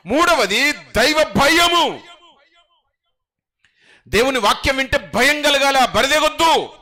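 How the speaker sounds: loud, high, slow preaching build; rising sermon cry; full-force preaching. Acted. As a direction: proclaim it to the whole hall.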